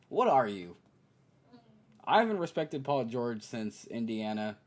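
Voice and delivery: ordinary talk, nothing marked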